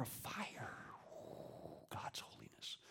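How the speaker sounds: hushed, reverent whisper; awed whisper. Acted. Preacher whispering in awe of God's fire. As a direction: breathe it out in wonder.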